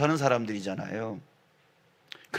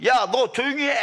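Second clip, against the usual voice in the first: high, raised-voice mock rebuke; shouted scolding; 10 semitones higher, 4 dB louder than usual. Crowd scolding the blind man, high sharp shout. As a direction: shush him with an acted yell. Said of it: "사람들이 소경을 향해서 소리 질렀어요".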